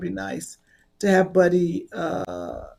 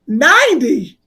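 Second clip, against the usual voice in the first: loud incredulous "Ninety?"; comic hearing her friend must do ninety minutes, loud shocked disbelief; 4 semitones higher, 10 dB louder than usual. Real, not acted. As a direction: blurt it out in disbelief, louder.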